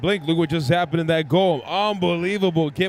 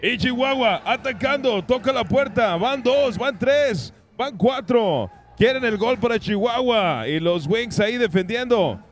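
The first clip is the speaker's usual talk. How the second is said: Spanish play-by-play; 5 semitones higher than usual. Real, not acted.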